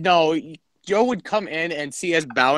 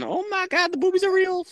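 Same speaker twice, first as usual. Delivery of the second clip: much higher, softer mock squeal of awe; imitating Chris; 4 dB quieter than usual. Friend pictures Chris gasping at the drawing, high soft squeal. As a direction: squeak it like a shocked teen. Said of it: "you would see Chris going".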